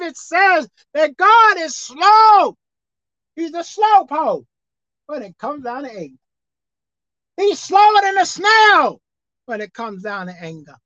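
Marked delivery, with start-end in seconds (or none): 0.0-4.4 preaching shout. Acted. Preacher drives home the point. 7.4-9.0 preaching shout. Acted. Preacher drives home the point.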